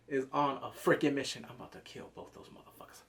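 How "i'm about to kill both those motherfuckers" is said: breathy whisper of a killer's plan; menacing whisper. Retold or imitated. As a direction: hiss it like a secret threat. Voicing Aemond's murderous intent.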